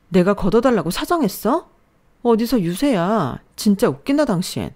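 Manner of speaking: mildly heated comeback; a touch of scornful indignation; indignant retort. A narrator's reading. Wife snaps back at husband's boasting, mildly indignant.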